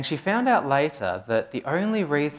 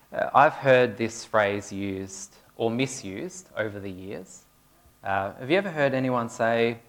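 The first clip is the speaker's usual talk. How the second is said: usual speaking voice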